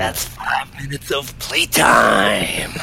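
slow gravelly wrestler whisper-shout; Macho Man voice. Retold or imitated. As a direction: drag it out in a raspy growl. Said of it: "his just amazing gravelly v-, voice".

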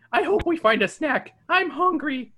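whiny hungry Shaggy. Acted. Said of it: "Shaggy says".

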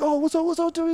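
high-pitched mock hello; retold greeting. Escorted tripper to people he knows, mock chummy. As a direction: imitate an over-friendly high greeting.

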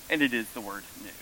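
usual speaking voice

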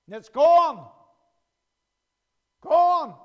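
loud, punched "gone" then "Gone." alone; emphatic. Acted. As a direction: slam the word "gone". Money spent, emphasis.

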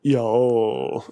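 drawn-out British "Yo" for y'all; British attempt at y'all. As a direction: imitate a British person straining at y'all. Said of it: "ask a British person to say y'all".

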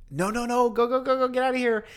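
high, urgent rapid-fire repeats; acted alarm, pushing someone to flee; mock panic.

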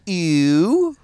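slow Balmerese "ew", drawn out; Baltimore vowel demo. Shown as a demonstration. Comedian teaching the hosts Balmerese vowels, Baltimore accent. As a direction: hold the Baltimore "ew". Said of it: "the Balmerese vowels".

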